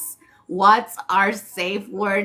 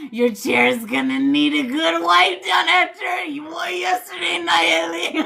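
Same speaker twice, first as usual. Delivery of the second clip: teasing callout; 7 semitones higher than usual. Pretend.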